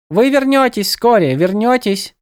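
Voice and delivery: high, lilting birdlike goddess; sing-song, echoing her own words; bird goddess voice. A narrator's reading. Goddess farewell.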